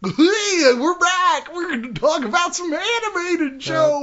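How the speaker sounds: hammed-up show-host welcome; announcer voice. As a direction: boom the return from break like a radio host. Coming back from break.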